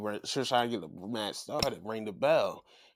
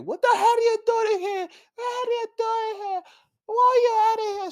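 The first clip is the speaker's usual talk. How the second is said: high, loud shouted mock rage; mock furious Becky; 11 dB louder than usual. Becky imitated yelling at AJ Lee for showing up, loud and high. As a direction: scream at the intruder in a high, hammed-up yell. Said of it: "Becky's like".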